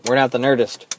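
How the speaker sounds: normal talking voice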